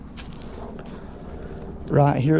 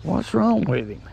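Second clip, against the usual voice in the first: usual speaking voice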